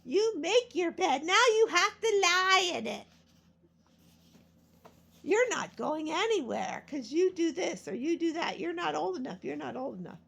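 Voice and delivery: high, loud nagging scold; voicing a parent scolding her, high and loud; mock scolding parent. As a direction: mimic a parent laying down the law. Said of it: "parent voiced in a scold".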